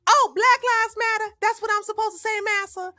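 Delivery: high, eager, fawning mock servant; mock servile slave voice. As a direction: grovel cheerfully to the master. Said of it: "the way Massa wants me to think".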